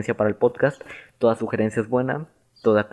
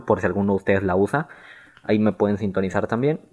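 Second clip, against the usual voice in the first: ordinary talk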